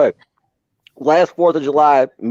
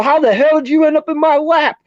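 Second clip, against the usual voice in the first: higher, exasperated mock yell; retold exasperated yell; 12 semitones higher than usual. Pinned under his brother, he yells at him higher and exasperated. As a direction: act out yelling at the brother who landed in your lap.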